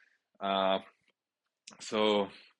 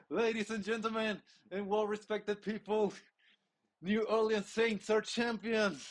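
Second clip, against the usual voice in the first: high, slow grand announcement; mock announcer; 10 semitones higher than usual. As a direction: proclaim it like a stadium announcer.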